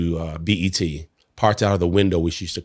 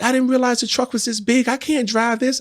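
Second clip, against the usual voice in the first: much higher, excited imitation; overwhelmed wife. Wife stunned by truck's size, high excited voice. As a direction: gush like she can't believe it.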